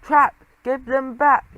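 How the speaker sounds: high, loud, slow shout. Acted. Geronimo chases his cousin through the street, shouting loud.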